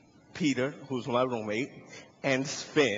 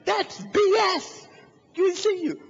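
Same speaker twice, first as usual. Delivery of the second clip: high, loud mock outrage; hammed-up angry cop yell; disbelieving policeman; 5 dB louder than usual. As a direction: shout down the suspect's excuse. Cop angrily rejects his explanation.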